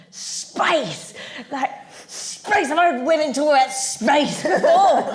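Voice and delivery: screeching tirade, acted out for laughs; retold scream. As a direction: imitate an outburst, breaking toward laughter. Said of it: "And he screamed".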